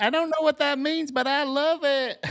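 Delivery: put-on high-pitched, gleeful kid voice; Ricky Bobby's kids. Quoting a movie kid cheering on anarchy, high-pitched glee. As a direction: shout it like an overexcited child, drawing out the end. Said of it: "Ricky Bobby's kids".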